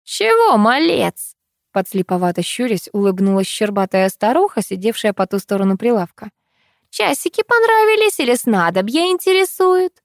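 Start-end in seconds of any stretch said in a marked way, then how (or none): 0.1-1.4 put-on old crone voice. A narrator's reading. Old crone speaks, high pitch. 6.9-9.9 put-on high cackly old woman voice; old crone voice. A narrator's reading. Old crone trader, high far.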